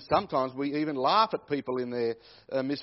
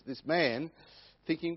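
normal talking voice

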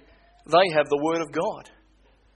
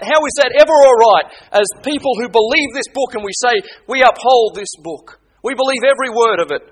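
louder, higher preaching at full force; fiery preaching; 6 semitones higher, 7 dB louder than usual. Acted. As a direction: thunder it from the pulpit, pressing them hard. Preacher exhorting the congregation.

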